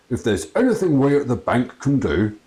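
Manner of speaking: bumbling condolences from a greasy boss; hammed-up awkward sympathy; banker voice.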